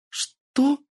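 a sudden high cry of disbelief; the word torn out of her in shock; shocked gasp. Acted. Girl hearing her grandfather is dead, shocked cry. Hears grandfather was killed.

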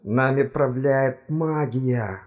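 slow, muffled words forced out; through clenched teeth. A narrator's reading. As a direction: squeeze each word past a locked jaw. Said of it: "Зубы упрямо не желали разжиматься". (выдавливая слова сквозь стиснутые зубы)

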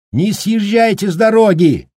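громко, высоко, перекрикивая ветер; орать прямо в ухо; вопль сквозь ветер. Acted. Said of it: "завопил он прямо в ухо". (вопит сквозь вьюгу)

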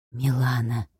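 hushed male whisper, low and soft. A narrator's reading. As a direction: breathe the name in awe. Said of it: "прошептал Егор".

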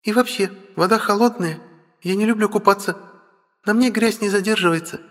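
put-on high, pouty, whiny girl; capricious pout. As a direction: sulk with pouting lips. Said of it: "капризно надувает губки".

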